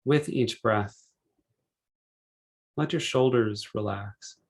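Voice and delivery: unhurried meditation guide, measured phrases with long pauses; calm, spacious relaxation-session delivery; guided meditation. Real, not acted.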